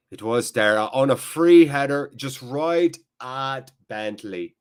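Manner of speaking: drawn-out, put-on Irish accent. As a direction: commentate in an amateur Irish brogue, slowing at the end. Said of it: "doing an Irish accent".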